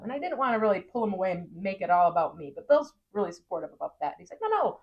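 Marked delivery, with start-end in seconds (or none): 4.4-4.8 higher, coaxing insistence; insistent urging. Retold or imitated. Husband coaxing his wife toward the crew, high and insistent. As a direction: gently push her to go.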